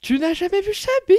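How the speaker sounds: high, loud goofy voice; dumb character voice. Acted. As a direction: ham up a dim-witted character. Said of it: "je prends tout de suite mon personnage".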